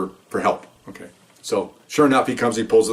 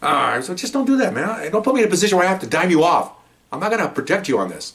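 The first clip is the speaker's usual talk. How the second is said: higher-pitched, urgent telling-off; exasperated warning; 8 semitones higher than usual. Retold or imitated. Officer warns partner not to do it again, retold exasperation. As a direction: re-enact pleading sternly with a colleague.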